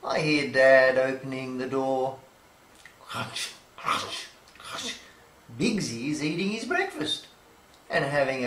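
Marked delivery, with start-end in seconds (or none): 3.0-4.9 soft, breathy crunch sounds. Acted. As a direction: whisper the crunching. Dog eating.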